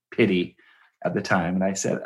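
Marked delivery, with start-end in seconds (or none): none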